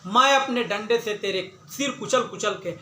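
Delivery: usual speaking voice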